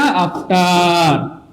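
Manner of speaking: After stretched very long and slow; drawn-out answer. Shown as a demonstration. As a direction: hold the word after like a chant. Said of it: "আর পরে মানে কি ভাইয়া?". (After শব্দটা অনেক টেনে বলা)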